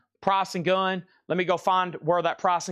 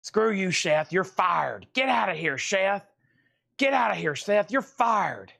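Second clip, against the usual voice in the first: higher mock-angry dog voice; voicing the dog; 5 semitones higher, slower than usual. Owner dubs his dog's chase video: higher mock scolding. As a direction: play the dog yelling at Seth to get out, higher. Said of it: "and Otis is like".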